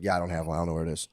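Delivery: low, quiet mock defeat; helpless resignation. Man asked for paperwork admits he's lost it, quiet resigned voice.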